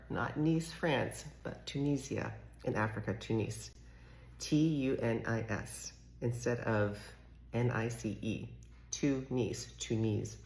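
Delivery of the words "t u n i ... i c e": T U N I S spelled letter by letter. Shown as a demonstration. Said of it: "spelled out".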